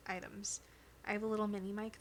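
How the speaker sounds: ordinary delivery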